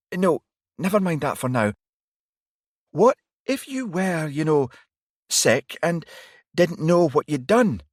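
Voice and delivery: a worried detective pushing back; alarmed young woman. A narrator's reading. Varry voiced high, probing.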